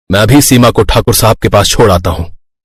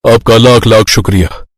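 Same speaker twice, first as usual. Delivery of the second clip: plain reading voice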